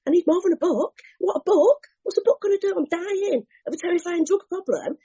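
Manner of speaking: high, disbelieving rant; can't believe what she's hearing; incredulous outburst. Retold or imitated. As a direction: addict rants in disbelief at a book. Outraged at being offered a book.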